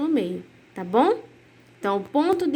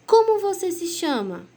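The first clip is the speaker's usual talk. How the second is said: asked as a real question, pitched higher; 6 semitones higher than usual. Shown as a demonstration. Teacher modelling a question for pupils, asked with a clear question tone. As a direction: read it like you truly want the answer. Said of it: "ler como se fosse um tom de pergunta mesmo".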